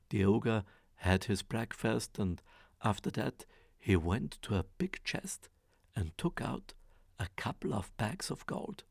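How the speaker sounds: usual speaking voice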